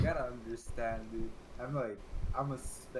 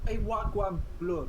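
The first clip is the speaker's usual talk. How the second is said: hammed-up roadman slang, higher; mock London street voice; london roadman accent; 9 semitones higher, 6 dB louder, slower than usual. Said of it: "What about the roadman, dude?".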